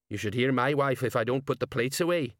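ordinary delivery

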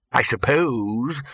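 slow, drawn-out musing. Acted. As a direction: let it stretch out slowly. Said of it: "said the bursar slowly".

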